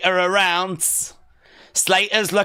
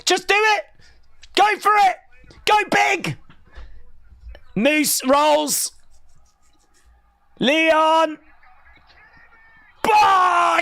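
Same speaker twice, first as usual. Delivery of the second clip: very high shouted victory call; wrestling commentator; 12 semitones higher than usual. Real, not acted. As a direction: scream the finish like a commentator. Hyped live wrestling call.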